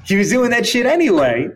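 high, loud laughing repeat; laughing through words. Real, not acted. Retelling the joke's punchline to cohost, laughing. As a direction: laugh as you say it.

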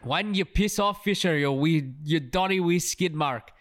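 hammed-up, off-the-cuff Scottish accent; amateur Scottish accent. Co-host quoting the film's insult on a dare, rough Scottish accent. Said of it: "I'm not gonna do the accent".

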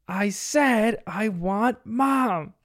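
slow, high-pitched insistence, each word stretched; fed-up child. Retold or imitated. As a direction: imitate a little girl who has had enough. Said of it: "Bingo has had enough".

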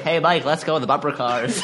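normal talking voice

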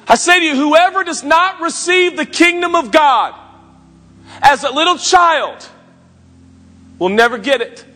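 high, loud, ringing pulpit delivery; full-force preaching. Acted. Pastor preaching to the congregation, at full force. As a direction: preach it out to the whole room.

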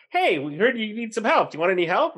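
retold cheery volunteers; eager helpers. Helpers offer help.

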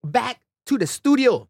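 slow, high, hammed-up podcast welcome; host announcer. Host opening the podcast to the audience, hammed-up announcer. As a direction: boom it out like a show host.

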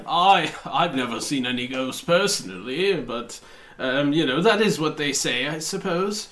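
a hint of a nervous official; lightly voiced fussy banker. GM voicing Martin Comey.

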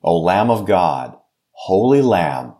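usual speaking voice

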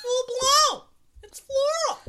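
very high, loud squawk; silly mock shout. Friends making weird sounds, silly high shout. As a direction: belt it out as a daft catchphrase.

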